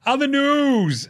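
segue announcer. Acted. Segue, slow high.

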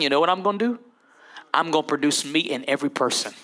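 lightly voiced, hushed resolve. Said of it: "He said".

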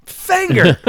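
high, loud, delighted yell of the new word; cheering the co-host who coined the word, with a delighted shout. Real, not acted.

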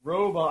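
Roboam repeated softly as "Ro-bo-am". Shown as a demonstration. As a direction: Roboam tried out quietly. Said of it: "we say Roboam".

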